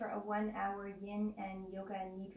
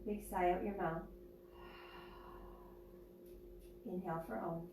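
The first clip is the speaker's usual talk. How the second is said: guided meditation; 3 dB quieter than usual. Acted. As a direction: ease them into stillness, unhurried.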